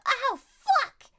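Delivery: high loud yelp of pain; sharp yell. Retold or imitated. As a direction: cry out as the trap bites. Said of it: "You sharply yell".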